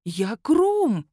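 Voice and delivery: high, loud correction; indignant. A narrator's reading. Werewolf to the magistrate, indignant correction. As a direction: snap back in outrage. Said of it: "возмутился".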